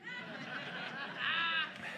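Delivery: a long high giggle; high laugh. Real, not acted. Son proposes swim, pastor laughs high. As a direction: giggle at the memory.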